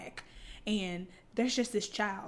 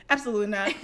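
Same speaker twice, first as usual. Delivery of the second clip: usual speaking voice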